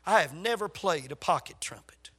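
normal talking voice

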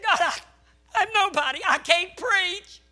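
high, loud, drawn-out pleading wail; anguished cry. Retold or imitated. Father pleading with God that he can't preach, wailing.

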